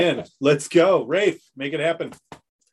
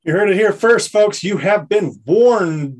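loud announcer; 4 semitones higher, 7 dB louder, slower than usual. Real, not acted. Echoing the warning loudly.

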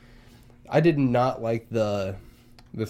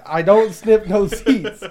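laughing; 9 semitones higher, 8 dB louder, much slower than usual. Real, not acted.

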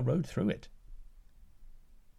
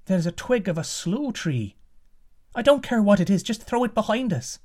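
much higher, fast urgent voice; urgent daughter; 9 semitones higher, 4 dB louder than usual. Acted. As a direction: hurry him, high and breathless with urgency. Daughter urges the prince.

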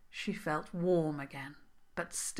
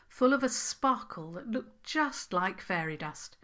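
plain reading voice

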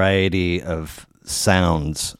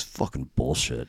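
grumbling passenger; 7 semitones lower, 9 dB quieter than usual. Retold or imitated. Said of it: "the guy next to me goes".

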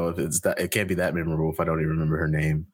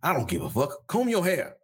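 Mister T impression; 7 semitones higher than usual. Doing Mister T for the podcast audience, scolding in a hammed-up voice. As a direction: lecture them like Mister T. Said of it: "I can do Mister T right now".

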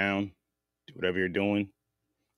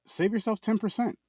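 high ad voice; 12 semitones higher, 3 dB quieter than usual. Acted. Ad read, high.

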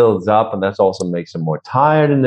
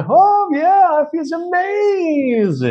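soaring, drawn-out high melody; melodic high voice; much slower than usual. Shown as a demonstration. Coach showing he can have melody, high and singing. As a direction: sweep way up high and stretch it out. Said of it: "I also can have melody".

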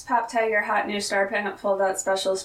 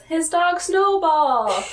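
slow, high, drawn-out quote; imitated wonder; 7 semitones higher, 4 dB louder, much slower than usual. [name] reading the magazine answer, in possibly genuine wonder. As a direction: stretch it out high, as if impressed. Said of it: "is he genuine?".

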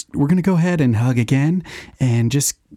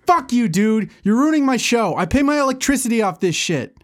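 pitched-up angry berating; angry high rant; 12 semitones higher than usual. Acted. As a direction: tear into him. Angry at friend ruining show.

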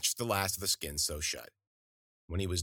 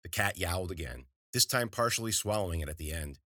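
ordinary delivery

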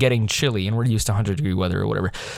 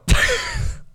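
high laugh. Real, not acted. Laughs at own blunder.

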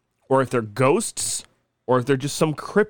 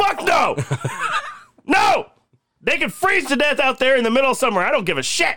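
mock outraged refusal; 12 semitones higher than usual. Podcaster refusing to let creepy kids in, mock outraged. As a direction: yell it in exaggerated alarm.

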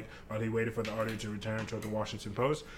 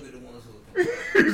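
high-pitched burst of laughter; 4 dB louder than usual. Real, not acted. Laughing at friend.

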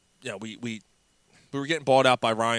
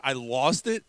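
normal talking voice

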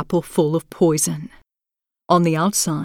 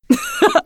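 high, wild witch cackle; evil cackle. A narrator's reading. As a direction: burst out in savage laughter. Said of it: "burst out laughing".

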